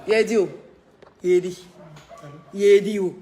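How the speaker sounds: loud call across the room. Real, not acted. Calling a worker by name, loud call.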